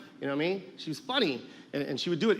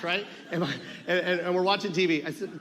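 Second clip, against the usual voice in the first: normal talking voice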